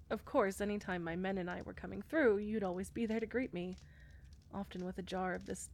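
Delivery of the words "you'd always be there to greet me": usual speaking voice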